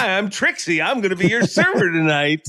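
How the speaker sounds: lightly voiced waitress introducing herself; waitress greeting. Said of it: "they come up to your table and they're like".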